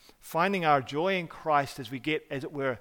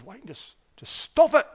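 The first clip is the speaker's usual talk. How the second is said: frustrated plea; 8 semitones higher, 6 dB louder than usual. Retold or imitated. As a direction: retell an exasperated outburst to a friend. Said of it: "I'm just frustrated. I said".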